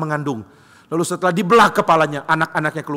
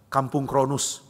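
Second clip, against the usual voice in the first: ordinary delivery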